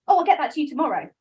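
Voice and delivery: quick, bright, loud promise; breezy overpromise. Retold or imitated. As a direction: toss it off fast and chirpy. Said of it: "So when I say".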